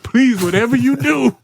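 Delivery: high-pitched, mock-desperate begging; hammed-up plea with a long, high "please"; scared pleading. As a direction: beg comically not to be sent back in. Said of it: "ever scared to be".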